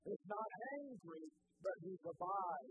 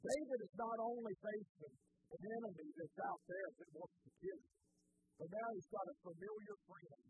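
normal talking voice

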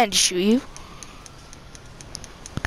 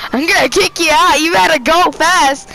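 louder, higher playful threat; mock threat; 4 semitones higher, 4 dB louder than usual. Real, not acted. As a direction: menace him half-jokingly. Threatening teammate in the car.

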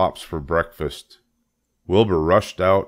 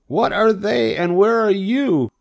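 very high, loud, frantic demand; screaming; 5 dB louder than usual. A narrator's reading. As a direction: cry out in a high pig voice. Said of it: "screamed Wilbur".

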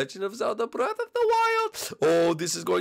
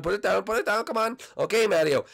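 ordinary talk, nothing marked

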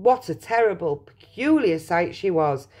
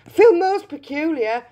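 put-on high, uneasy girl's voice; a touch of a startled gasp; mild gasp; 10 semitones higher, 6 dB louder than usual. A narrator's reading. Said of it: "gasped Violet".

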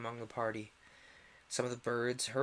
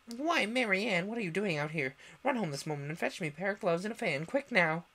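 higher, louder, fast scolding; angry rabbit; 6 semitones higher, 4 dB louder than usual. A narrator's reading. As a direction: order the maid about sharply. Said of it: "in an angry tone".

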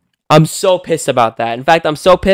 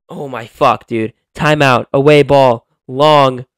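normal talking voice

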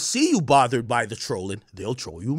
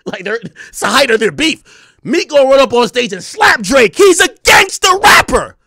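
building to a high, loud shout; hyped anticipation; 7 semitones higher, 8 dB louder than usual. Retold or imitated. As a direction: get carried away predicting a showdown. Retold excited prediction of a fight.

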